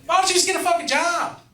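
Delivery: high-pitched, loud mock yell; retold yell. Driver to the man at the car window, yelling. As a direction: holler it at him in exasperation. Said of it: "I'm like, again".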